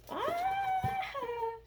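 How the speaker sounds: very high, drawn-out delighted squeal; long high-pitched "ah"; excited squeal. Real, not acted. Excited squeal on opening parcel.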